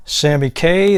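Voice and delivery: usual speaking voice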